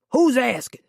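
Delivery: guarded Southern man, curt; short, suspicious Southern reply; Southern accent. Acted. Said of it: "He had a heavy Southern accent".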